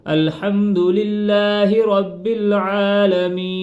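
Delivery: very slow, tuneful Quran recitation; drawn-out recitation with a clear ع; melodic recitation. Shown as a demonstration. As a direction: chant it out long and clear. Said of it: "ہمزہ کھڑی زبر آ".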